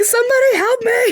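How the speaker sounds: high-pitched mock cry for help; hammed-up panicked plea. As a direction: act out a desperate call for rescue.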